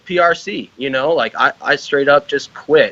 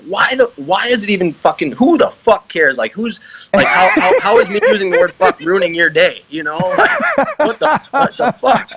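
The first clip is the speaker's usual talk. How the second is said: exasperated rant; 6 semitones higher than usual. Retold or imitated. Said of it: "I'm like".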